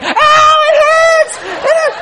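very high, drawn-out shriek of pain; child screaming. Retold or imitated. Son with urine on his sore toes, screaming. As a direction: imitate a little boy screaming in agony.

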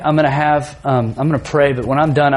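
normal talking voice

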